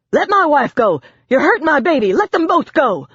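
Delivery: high, loud, desperate pleading yell; frantic shouting. A narrator's reading. Father screaming at officers to free his wife and baby. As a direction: scream it for your family.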